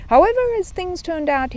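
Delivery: ordinary delivery, nothing marked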